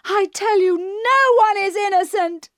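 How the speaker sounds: rising fury until she is almost in tears; building to shouting. A narrator's reading. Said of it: "She was shouting now, and nearly crying".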